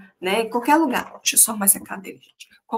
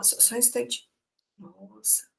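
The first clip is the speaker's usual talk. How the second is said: much softer, mumbled aside; distracted aside; 11 dB quieter than usual. Shown as a demonstration. Teacher ignoring the class, murmuring to herself. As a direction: turn away mid-lesson and murmur. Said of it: "se eu ficasse assim".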